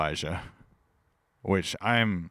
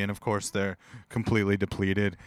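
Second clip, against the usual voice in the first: ordinary talk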